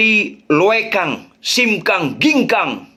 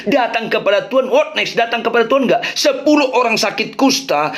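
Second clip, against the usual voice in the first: ordinary talk, nothing marked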